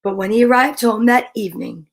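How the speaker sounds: ordinary delivery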